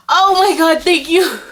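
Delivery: high, breathless thanks; excited. Real, not acted. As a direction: gush with delight. Overjoyed by gift.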